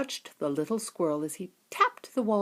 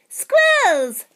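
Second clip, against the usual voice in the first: put-on high loud girl's shout; 12 dB louder than usual. Veruca spotting the squirrels, high loud shout. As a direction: yell it out, thrilled. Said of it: "shouted Veruca Salt".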